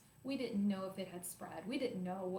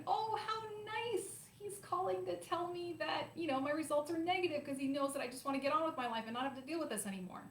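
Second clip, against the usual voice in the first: gushing delight; 9 semitones higher than usual. Retold or imitated. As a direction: play up naive relief, pitched way up. Retold naive hopeful thought.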